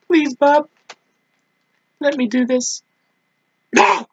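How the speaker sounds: high-pitched desperate character voice; pleading game heroine. Acted. Game heroine begging to keep the knife, high pleading. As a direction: plead high, then burst out on the last word.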